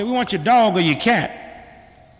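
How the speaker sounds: slow, loud, deliberate; army caller. Retold or imitated. Army man tells the homeowner they want his pets, slow and loud. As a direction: spell out the absurd demand slowly.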